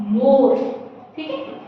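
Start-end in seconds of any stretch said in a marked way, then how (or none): 0.1-0.5 मोर low and firm with a plain o; model word. Shown as a demonstration. Teacher repeating the correct मोर. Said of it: "ओ की मात्रा है".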